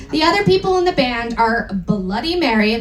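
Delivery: ordinary talk, nothing marked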